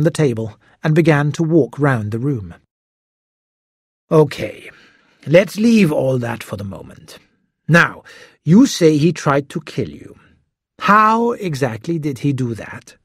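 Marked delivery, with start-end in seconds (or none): 4.1-13.0 measured, probing detective voice; lightly voiced detective. A narrator's reading. Detective pacing, questioning Hiro, measured. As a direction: move on, still pressing.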